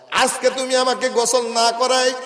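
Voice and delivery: high, loud chanted accusation; preaching at full force. Acted. Old woman tells the stranger she knows, high loud chant. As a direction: belt it out, stretching the final words.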